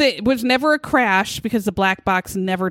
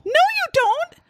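squeaky high disbelief; No way, cry it out in shock; high-pitched scoffing retort; incredulous. Real, not acted. Host scoffs at witness claim.

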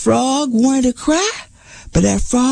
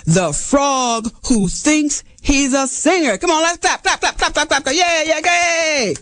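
hyped-up stage host; showman emcee. Acted. Fox opens the show for the crowd, hyped emcee. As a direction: work the crowd like a slick emcee.

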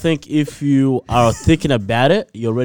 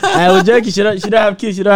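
laughing; 9 semitones higher, 3 dB louder than usual. Real, not acted. Laughing after a joke backfired.

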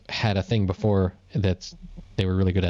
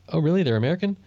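mock surprise; 6 semitones higher, much faster than usual. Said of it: "then you're surprised that".

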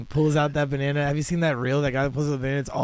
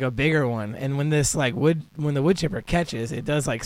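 normal talking voice

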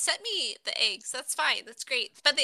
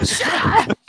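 loud, high shout at interrupters; exasperated shout; 8 semitones higher, 7 dB louder than usual. Real, not acted. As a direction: yell over the hecklers. Interrupted while telling a story.

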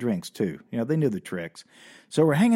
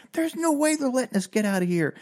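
panicked worry; 10 semitones higher than usual. Retold or imitated. Panicking teller.

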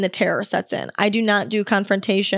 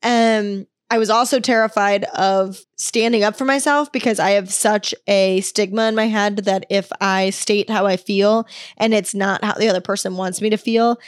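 ordinary talk, nothing marked

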